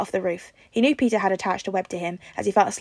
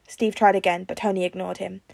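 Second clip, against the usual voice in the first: ordinary delivery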